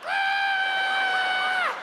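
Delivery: high piercing scream. Retold or imitated. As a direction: scream in panic. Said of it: "we were all like".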